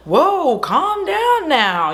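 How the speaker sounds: lightly imitated Texan drawl, high and slow; Southern drawl. The Texan gas station man soothing Sally, slow mock drawl. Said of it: "You know, true Texan, Southern gentleman is like".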